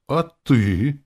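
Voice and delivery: high, loud retort; exclaiming. A narrator's reading. Hero turns the reproach on the demon, exclaiming. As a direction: throw it back as an exclamation. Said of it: "воскликнул я".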